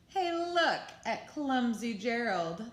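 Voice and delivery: loud, slow jeering at Gerald; mock him from the crowd; sneering taunt. A narrator's reading. Animals jeering at Gerald on the dance floor, loud sneer. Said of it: "the animals all sneered".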